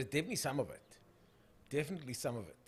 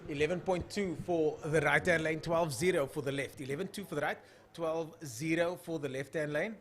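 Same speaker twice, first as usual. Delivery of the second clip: louder, punchy trackside commentary; projected drag race announcer delivery; live race call; 6 dB louder than usual. Real, not acted. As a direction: call the launch and times to the crowd.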